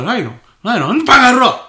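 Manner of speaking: sudden loud yell at the clerk; angry yell. Retold or imitated. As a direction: blow up with a shout. Old man suddenly yells insult.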